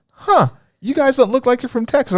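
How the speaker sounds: high-pitched mock puzzlement; imitated doubtful waitress. Host voicing a waitress doubting Texans, high puzzled voice. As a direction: put on a high voice, sizing up the tourists. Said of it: "She's like".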